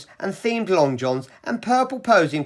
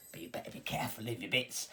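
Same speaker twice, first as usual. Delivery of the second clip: hushed aside; 7 semitones lower, 15 dB quieter, faster than usual. Acted. Aside joke to the audience.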